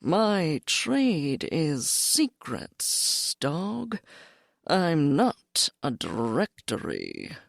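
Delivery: slow, bored cat voice. Acted. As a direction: drawl it out, utterly uninterested. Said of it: "In a bored voice".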